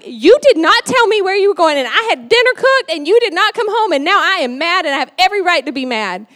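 high, loud scolding of a husband; acted-out angry wife rant; mad. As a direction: let him have it, punching each word. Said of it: "like, we are mad".

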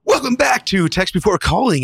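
high-pitched, hammed-up host welcome; show intro. Podcast host performs show intro.

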